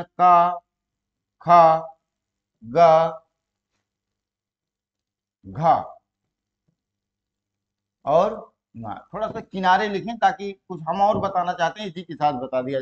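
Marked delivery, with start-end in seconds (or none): 0.0-8.9 each letter with a long aa, said loud and apart; का, खा, गा stretched and called out; drawn out letters. Shown as a demonstration. Teacher recites letters with long vowels for the class. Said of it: "कौन कौन है".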